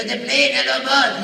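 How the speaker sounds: punchy little catchphrase impersonation; Hervé Villechaize. Acted. Voice actor shows whose voice he stole, celebrity impression. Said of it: "Hervé Villechaize".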